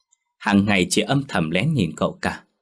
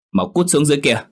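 louder, higher barked command; angry order; 7 semitones higher, 4 dB louder than usual. Acted. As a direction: throw her out sharply. Master ordering the maid out.

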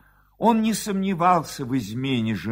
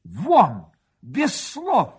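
sharp, raised-pitch command; angry dismissal; 7 semitones higher, 4 dB louder, much slower than usual. A narrator's reading. Husband throwing wife and rival out, angry command. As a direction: throw them out, loud and high. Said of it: "глухо сказал Матушкин".

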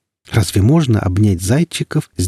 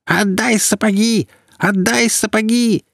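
put-on much higher screeching old crone; screeching old woman; 12 semitones higher than usual. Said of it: "истошно орала".

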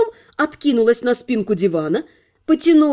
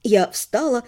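ordinary delivery, nothing marked

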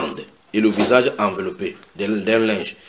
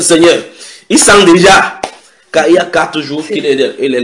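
loud, raised-pitch objection; acted protest; 6 semitones higher, 11 dB louder than usual. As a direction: protest urgently as Martha, easing toward the end. Voicing Martha's objection to Jesus. (protestation vive, jouée)

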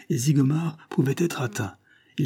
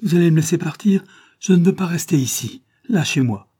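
raised, higher voice, insisting; agitated demand; 7 semitones higher, 8 dB louder than usual. A narrator's reading. Captive young woman to her captor, agitated demand. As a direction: demand to be let go, loudly.

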